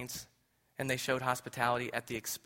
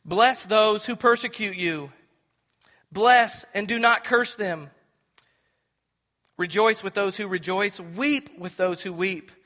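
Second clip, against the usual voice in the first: slow, louder scripture read; solemn public reading; 7 semitones higher, 9 dB louder, slower than usual. Real, not acted. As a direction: proclaim the verses slowly. Reads scripture aloud.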